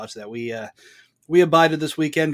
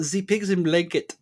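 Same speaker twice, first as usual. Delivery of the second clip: lightly mimicked German accent, higher pitch; a touch of movie-nihilist German; 5 semitones higher than usual. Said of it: "in that weird German accent".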